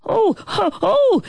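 high eager gasps; excited. A narrator's reading. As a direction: bounce with sudden excitement. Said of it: "the fly said excitedly".

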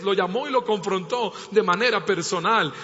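ordinary talk

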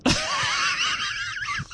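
high, breathy laughing fit; host laughing hard at the Turkish prison taffy joke; long laugh. Real, not acted. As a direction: lose it completely.

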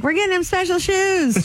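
a high, playful imitation of a yell from across the room; mock yell. Retelling his wife calling out from far away, mock yell. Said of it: "She, like, yelled from far away".

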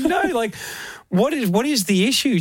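high exasperated no; exasperated disbelief. Retold or imitated. To wife about the new house, high exasperated disbelief. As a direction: throw up your hands in disbelief. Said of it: "I said".

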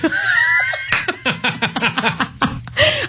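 high squealing burst of laughter. Real, not acted. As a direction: shriek with embarrassed laughter. Host laughs after teasing co-host.

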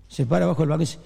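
ordinary delivery

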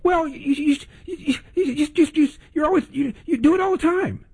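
mock stammering, very high-pitched; flustered caller. Host imitates caller unable to name one, mock flustered. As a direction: stumble over each word in a squeaky caller impression. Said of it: "Name one".

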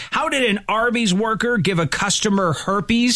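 high-pitched teaser question; radio host teasing the next segment. Acted. Radio tease of coming stories.

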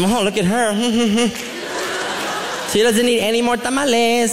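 catty gossip, laughing mid-line; mock aunts snickering at a cousin. Aunts gossiping in the kitchen, snickering. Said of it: "They're talking".

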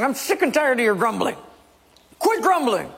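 fed-up leader snapping at the crowd; exasperated Moses. Acted. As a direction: Moses losing patience with the grumblers. Moses scolding grumbling people.

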